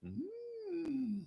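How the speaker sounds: thin eerie falsetto hum; creepy high hum. Retold or imitated. Comic doing a creepy host, eerie hum. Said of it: "I'm Keith Morrison".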